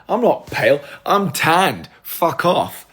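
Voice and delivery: high, loud, affronted retort; indignant protest. Real, not acted. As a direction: protest loudly as if insulted. Protests being called pale.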